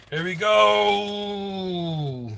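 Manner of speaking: very slow announcer-style here we go; drawn-out takeoff. Pretend. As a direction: stretch the go way out, very slow. Rocket takeoff countdown moment.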